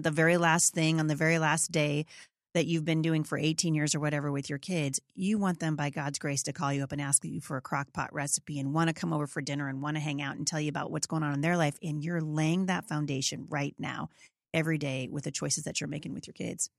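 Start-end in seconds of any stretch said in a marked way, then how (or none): none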